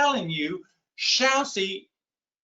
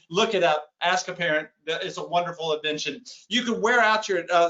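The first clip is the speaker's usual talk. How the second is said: ordinary talk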